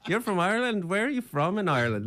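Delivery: high-pitched Irish brogue, questioning; Irish bartender. Retold or imitated. Bartender who has him busted, high Irish accent. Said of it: "Like, he was Irish".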